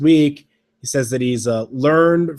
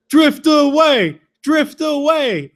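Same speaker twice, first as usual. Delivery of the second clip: high, drawn-out chanting yell; mock crowd yell; 11 semitones higher, 4 dB louder, much slower than usual. Fan heckling the wrestler mid-match, mock yell. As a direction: imitate a heckler stretching out each word, high. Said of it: "one guy just starts yelling".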